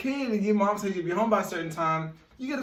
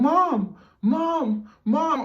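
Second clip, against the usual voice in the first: higher, louder calls; calling out; 10 semitones higher, 3 dB louder, much slower than usual. Retold or imitated. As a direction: call up for mom from halfway down the stairs. Peeking down stairs calling mom.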